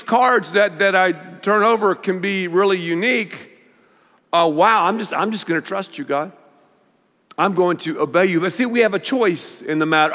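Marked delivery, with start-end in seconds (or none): none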